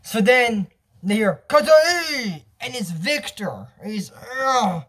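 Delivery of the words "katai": calling out. Retold or imitated. Character calls out loudly.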